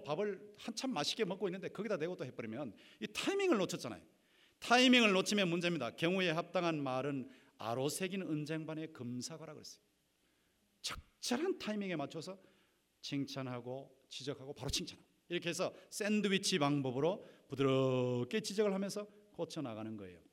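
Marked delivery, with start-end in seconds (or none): none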